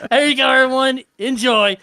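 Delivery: loud, high-pitched mock hostess; hammed-up cook presenting a meal; imitated cook serving. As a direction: call the party to eat, playfully loud. Acting out the cook serving food.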